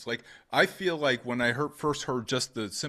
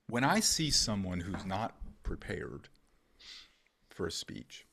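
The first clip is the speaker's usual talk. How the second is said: confidential voice; 4 semitones lower, 8 dB quieter than usual. Shown as a demonstration. Speaker jokes he drops into a hushed confidential voice. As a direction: lean in and tell a secret. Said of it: "talking in confidential voice right now".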